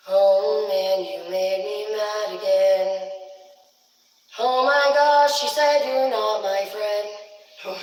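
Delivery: loud, slow, drawn-out complaint; wailing mock-angry parent; mock outraged wail. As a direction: stretch each phrase out in hammed-up hurt. Acted wronged parent wailing.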